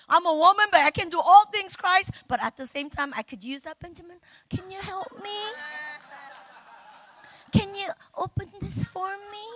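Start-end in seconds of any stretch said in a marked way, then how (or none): 0.1-2.1 loud, raised voice of independence; bold declaration. Acted. Woman declares she can do all things, bold declaration. 3.8-9.4 high, soft little plea; helpless wife plea. Acted. Wife asks Benjamin to open something, helpless plea.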